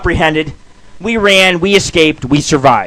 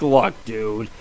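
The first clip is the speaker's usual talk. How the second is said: low, much softer voice; calm aside; 9 semitones lower, 11 dB quieter than usual. Retold or imitated. Retold reply to the buddy in the grave, calm and quiet. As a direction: drop down to settle a panicking friend. Calming his panicking buddy in story.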